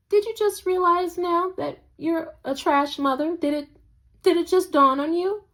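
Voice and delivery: high, mocking questions at the screen; sarcastic jab. Real, not acted. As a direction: sneer it up at the mother.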